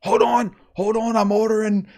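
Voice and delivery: high, loud distracted ordering; hammed-up preacher ordering fast food; goofy preacher voice. Friend as the preacher pausing for McDonald's, hammed-up. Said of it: "my buddy's like".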